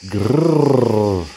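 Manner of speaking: a small beast's grrr; baby growl. A narrator's reading. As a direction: growl it, rough and rumbling. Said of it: "το μωρό άρχισε να γρυλίζει".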